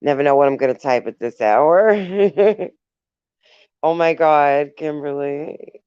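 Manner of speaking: ordinary delivery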